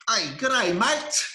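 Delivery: loud, high-pitched mock Aussie greeting; mock Australian accent. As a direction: tease with an exaggerated Australian accent. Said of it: "Your accent, man. You're bloody Australian".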